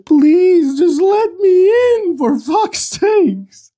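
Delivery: high, drawn-out tearful wail; exasperated mock sobbing; whining plea. Real, not acted. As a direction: beg as if about to cry. Begging a slow setup screen.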